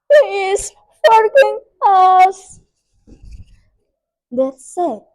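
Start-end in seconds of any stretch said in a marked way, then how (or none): 0.1-2.6 loud, high, drawn-out tearful plea; frightened, wailing appeal; begging for mercy. A narrator's reading. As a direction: plead slowly as if crying. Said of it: "begged the witch forgiveness".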